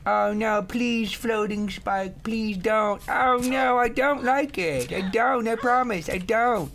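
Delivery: high, whimpery begging voice; mock pleading. Gamer begging a floating spike, high mock plea. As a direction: beg in a high put-on voice.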